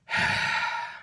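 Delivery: long breathy exhale; heavy sigh. Real, not acted. Tired vlogger pausing mid-story, heavy sigh. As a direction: let out a big sigh.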